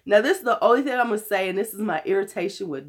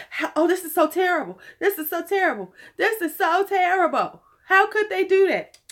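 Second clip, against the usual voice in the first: mocking dramatic fans; 9 semitones higher than usual. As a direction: overact the complainers' wailing. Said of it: "they're acting like".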